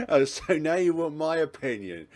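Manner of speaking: high, loud, amused sarcasm; laughing protest. Acted. Partner finally asks his view, laughing indignation. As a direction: crack up, then throw it back loudly. Cracks up reading the scene.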